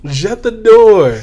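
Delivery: mock French accent, slow and loud; loud, drawn-out fake French accent; hammy French movie accent. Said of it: "When he's speaking in a French accent".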